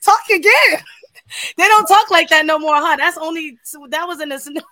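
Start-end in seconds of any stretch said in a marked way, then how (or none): 0.0-0.8 louder, higher, acted-out swooning delight; thrilled reaction. Woman retelling her reaction to his accent, thrilled. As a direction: gush as if begging to hear more.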